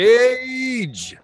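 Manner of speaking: loud, high, happy call-out; excited shout. Real, not acted. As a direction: shout the name like greeting a friend. Greeting a viewer in chat.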